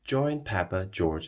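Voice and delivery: ordinary delivery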